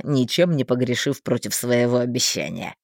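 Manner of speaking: put-on deep, sly old man voice; low male voice. A narrator's reading. Thin man's voice, low.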